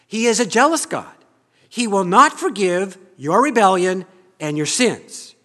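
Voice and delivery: loud stern warning; Joshua's warning. Acted. Joshua rebuking the people, loud stern warning. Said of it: "Joshua says to the people".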